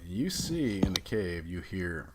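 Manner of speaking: ordinary delivery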